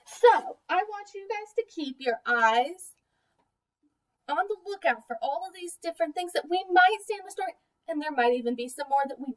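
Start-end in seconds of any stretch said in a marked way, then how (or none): none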